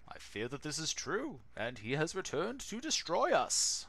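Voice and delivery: a solemn village elder warning of doom; wise old master. Acted. Wise old master's long tale.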